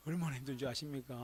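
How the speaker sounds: ordinary talk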